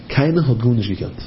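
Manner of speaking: ordinary talk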